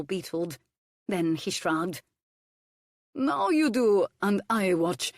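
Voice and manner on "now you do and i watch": put-on loud, high accented order from the cook. A narrator's reading. Hercules the cook ordering Violet to peel, loud and high. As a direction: bark it out as a foreign cook giving orders. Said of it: "he ordered".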